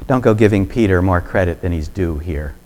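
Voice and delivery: ordinary talk, nothing marked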